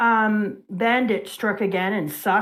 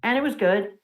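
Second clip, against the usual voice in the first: ordinary talk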